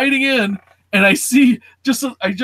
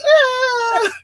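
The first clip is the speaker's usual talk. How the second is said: high mock crying wail; imitated panicked wail; 3 dB louder than usual. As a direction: mimic the sister's hysterical shriek. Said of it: "They're wiping her hands going".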